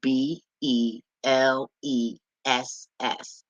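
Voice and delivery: letters spelled out very slowly one by one; slow letter-by-letter dictation; slow spelling. Shown as a demonstration. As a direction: spell it out deliberately like a teacher. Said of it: "let's spell that word bless".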